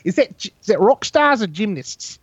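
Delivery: high-pitched New Zealand accent; Murray's New Zealand accent. Shown as a demonstration. Showing friends the accent they copied from the show, high New Zealand impression. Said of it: "that's kinda where my New Zealand voice comes from".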